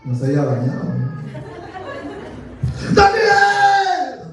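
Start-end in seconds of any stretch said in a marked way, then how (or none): 2.9-4.2 high, drawn-out mock-desperate cry; desperate call. Preacher as the king calling into the den, desperate call.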